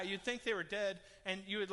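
ordinary talk

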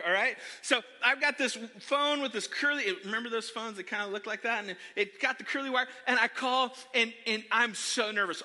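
ordinary talk

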